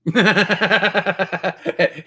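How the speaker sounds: high burst of laughter; laughing. Real, not acted. Game master laughing as the player blasts again. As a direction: laugh at the player's repeat move.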